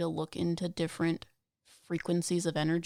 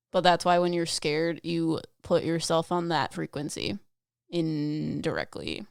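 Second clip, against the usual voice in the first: ordinary delivery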